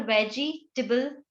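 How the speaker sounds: वेजिटेबल in two parts, "veji, tibble"; reduced table ending. Shown as a demonstration. Teacher explains the rule, slow वेजिटेबल with separated "tibble". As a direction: slowly break off a short "tih-bul" in वेजिटेबल. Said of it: "उसको टिबल बोलोगे".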